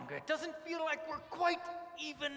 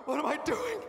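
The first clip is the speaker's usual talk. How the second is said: usual speaking voice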